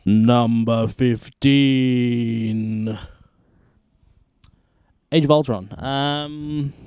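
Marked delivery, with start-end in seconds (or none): 0.1-5.8 slow, louder grand announce; announcer voice. Acted. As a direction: read the title like a trailer. Announcing countdown entry.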